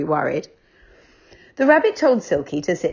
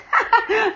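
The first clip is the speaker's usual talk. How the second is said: put-on high girlish squealing laugh; giggling little girl; squeal of laughter. A narrator's reading. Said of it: "a squeal of laughter".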